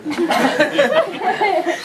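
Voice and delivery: high laughing burst. Real, not acted. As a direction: break into laughter. Laughing at own story.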